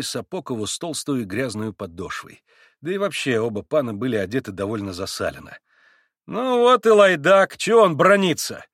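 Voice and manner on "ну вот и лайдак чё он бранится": higher, louder, slow angry retort. A narrator's reading. Grushenka angrily rebukes the Pole, louder. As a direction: snap back in anger. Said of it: "рассердилась вдруг Грушенька".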